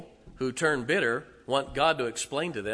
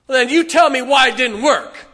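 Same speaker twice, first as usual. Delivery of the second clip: loud, high angry demand; mock angry demand; defiant demand; 8 semitones higher, 12 dB louder than usual. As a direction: challenge God to explain. Preacher voices a defiant believer.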